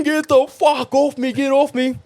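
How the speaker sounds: mock panic at the spider; freaking out. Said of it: "Just freaking out, going".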